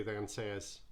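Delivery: plain reading voice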